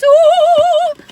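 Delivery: high, loud delighted ooh; excited squeal. Real, not acted. Vlogger opening the pink box, squealing with delight. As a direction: squeal at the sight of cookies.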